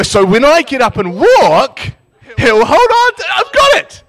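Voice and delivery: high, loud triumphant burst; very excited. Retold or imitated. Storyteller reinterpreting the prophecy in their favour, wildly excited. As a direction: exclaim as if cracking the puzzle. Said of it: "I was very excited again".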